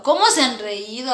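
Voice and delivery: usual speaking voice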